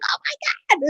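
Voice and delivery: light imitation of a stunned friend; amazed friend.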